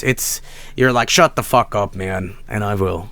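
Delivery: ordinary delivery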